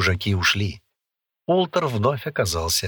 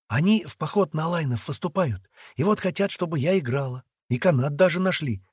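put-on higher, lively girl's voice; chattering young girl; 7 semitones higher, 4 dB louder than usual. A narrator's reading. Girl telling captive boy of ransom, chattering. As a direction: reassure him in a pitched-up chatter.

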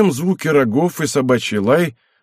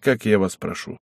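plain reading voice

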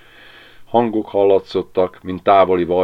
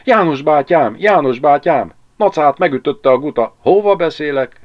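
loud, high cries of urgent news; panting alarm; 8 semitones higher, 7 dB louder than usual. A narrator's reading. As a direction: call out in agitated haste. (lihegve, izgatottan kiáltva)

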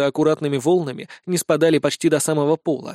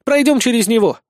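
high, louder exclamation; excited urging; 7 semitones higher, 5 dB louder than usual. A narrator's reading. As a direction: urge the group onward. Героиня зовёт спутников за собой. (бодрый призыв)

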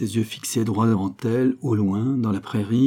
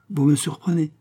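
higher-pitched, understated exasperated retort; mild exasperation; 6 semitones higher than usual. A narrator's reading. Young woman answers suitor's insistence, mildly exasperated. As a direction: repeat it with rising impatience. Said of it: "Elle était exaspérée par cette insistance".

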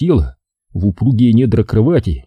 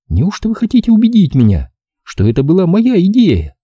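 higher girl's voice, a hint of husky whisper; 9 semitones higher than usual. A narrator's reading. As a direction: tempt him, a young woman's husky tease. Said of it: "хрипло прошептала она".

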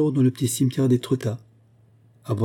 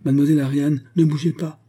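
higher, louder warning call; 6 semitones higher, 5 dB louder than usual. A narrator's reading. Ladolphe warns Ariane in the fog, calling out. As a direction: call to someone out of sight.

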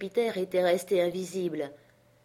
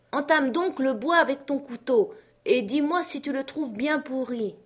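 high, raised instructions; urgent master; 7 semitones higher, 3 dB louder than usual. Acted. Master tells Jupiter to test the wood, urgent. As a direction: call orders up a tree. Legrand orders from below.

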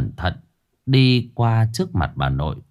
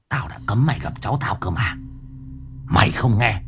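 throat growl; 3 dB quieter than usual. Acted. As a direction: growl the threat like a possessed old woman. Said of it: "nói gầm gừ trong cổ họng".

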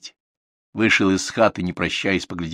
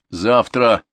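firm, louder refusal; stubborn; 3 semitones higher, 5 dB louder than usual. A narrator's reading. Viktor refuses to come out tomorrow, stubborn. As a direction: dig in, mildly obstinate. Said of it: "упрямо повторил Виктор".

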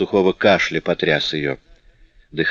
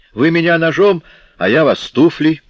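higher, louder defiance; defiant taunt; 10 semitones higher, 5 dB louder than usual. A narrator's reading. Girl daring bandits with knives, defiant. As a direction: throw out a raised, daring challenge. Éponine defies armed bandits.

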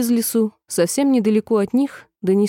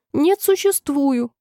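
high, slow, plaintive protest; understated tearful whine; 7 semitones higher, slower than usual. A narrator's reading. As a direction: insist it as if starting to cry. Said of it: "заплакала Алиса".

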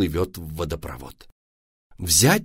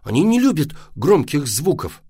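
put-on firm, higher-pitched boy's reproof; stern; 6 semitones higher, 4 dB louder than usual. A narrator's reading. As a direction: lay down the rule strictly. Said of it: "строго проговорил Вася".

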